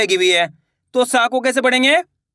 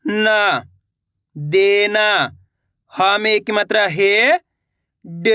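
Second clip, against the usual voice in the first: put-on slow, measured syllable-by-syllable reading for kids to repeat; slow spelling drill; slower than usual. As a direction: spell each word out patiently, pausing for the class. Teacher spells words syllable by syllable. (धीमे धीमे मात्रा जोड़कर पढ़ाना)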